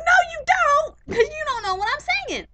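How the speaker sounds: very high, loud mocking squeal; mock shrieking retort. Vlogger mimics a guest's shrieking comeback, very high mock shout. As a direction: squeal it back in mock outrage. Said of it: "And then".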